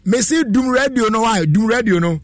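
high-pitched, exasperated repeat order; exasperated insistence. Real, not acted. Host repeats order to caller.

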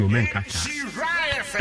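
high, very slow, drawn-out exclamation; a retold cry of surprise, slow and high; startled plea. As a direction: stretch it out high as if taken aback. Quoting own plea to mother.

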